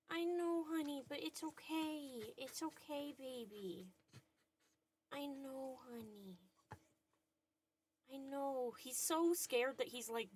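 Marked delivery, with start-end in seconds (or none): 0.1-8.7 soothing murmur. Real, not acted. Soothing dog during storm.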